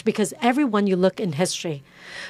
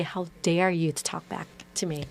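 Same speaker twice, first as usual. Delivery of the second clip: mild stern rebuke; 2 semitones lower, 7 dB quieter than usual. Retold or imitated. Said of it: "and she say".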